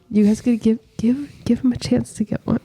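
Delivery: hushed aside to the group; whispering. Real, not acted. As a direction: whisper it behind a hand. Aside urging others to help.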